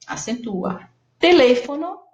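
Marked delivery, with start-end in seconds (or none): 1.2-2.0 Teléfono called out high and loud, stressing "lé"; teacher calling out an example word; emphasized example word. Shown as a demonstration. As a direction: present Teléfono as a model word with clear stress on "lé". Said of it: "antepenúltima tônica".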